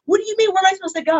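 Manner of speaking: high, indignant retold protest; mock upset students pleading where to go. Teacher quotes upset students, high indignant mock protest. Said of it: "'cause they were mad".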